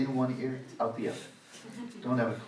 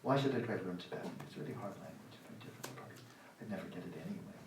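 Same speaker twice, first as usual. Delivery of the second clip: mock self-doubting mumble, trailing off softer; hushed, defeated self-talk, imitated; discouraged inner voice; 3 semitones lower, 8 dB quieter, faster than usual. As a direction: voice the lazy doubter under your breath. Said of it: "The sense that".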